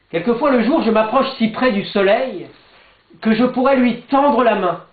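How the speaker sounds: impassioned declamation. Acted. Poet reaches out to the sun by day, lifted declamation. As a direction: let the poem soar toward the sun. Daytime counterpart, approaching the sun.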